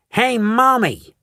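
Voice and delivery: high, slow bratty shout; Veruca shouting. A narrator's reading. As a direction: call out like a spoiled girl. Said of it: "shouted Veruca Salt suddenly".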